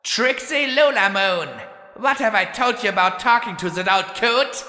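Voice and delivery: high, loud scolding shout; snapping mother. A narrator's reading. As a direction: bark the order at her. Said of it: "Then a mare's voice snapped".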